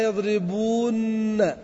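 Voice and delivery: slow demo. Shown as a demonstration. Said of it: "احذف النون الأولى".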